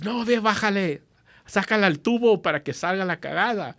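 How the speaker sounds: high, loud urgent Spanish orders; urgent dad. Retold or imitated. Dad ordering son to drain the tank, urgent loud Spanish. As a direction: bark the instructions in a panic.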